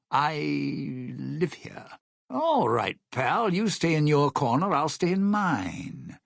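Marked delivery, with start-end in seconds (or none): none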